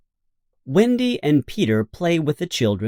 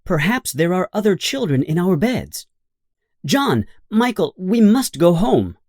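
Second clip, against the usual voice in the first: urgent Wendy; 7 semitones higher than usual. A narrator's reading. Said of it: "she says".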